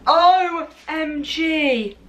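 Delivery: slow spelled-out excitement; drawn-out OMG. Real, not acted. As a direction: stretch each letter out.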